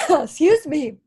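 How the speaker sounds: ordinary talk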